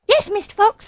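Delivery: very high, squeaky youngster; eager little animal voice; small badger. A narrator's reading. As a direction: pipe up obediently. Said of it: "said the small badger".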